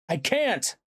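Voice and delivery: high, helpless cry; cried out. A narrator's reading. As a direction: cry out that you are powerless. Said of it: "I cried out".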